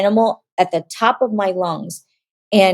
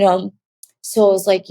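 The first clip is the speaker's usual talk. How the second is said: ordinary delivery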